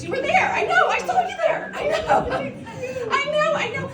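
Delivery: high, laughing excited call-outs. Real, not acted. Recognising people in the crowd.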